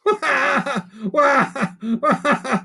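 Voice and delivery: high wailing cackle; creaky wailing laugh. Acted. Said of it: "creaky way of laughing".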